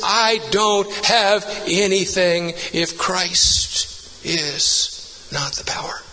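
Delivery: word by word, dropping to a hush; very slow emphasis sinking into near-whisper; slow, weighty climax. Real, not acted. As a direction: land it slowly and end hushed. Slows to hushed climax.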